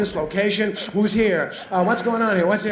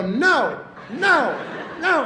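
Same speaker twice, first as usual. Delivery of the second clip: scolding no; 8 semitones higher, much slower than usual. Retold or imitated. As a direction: scold the dog sharply. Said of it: "you go".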